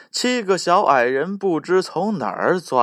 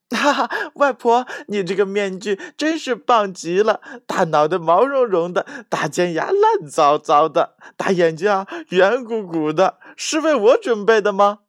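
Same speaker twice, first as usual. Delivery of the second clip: put-on high, bright childlike teasing, higher throughout; delighted little girl; 7 semitones higher, 3 dB louder than usual. Little girl admiring grandma's mask, high delighted teasing. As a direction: gush over the mask with glee.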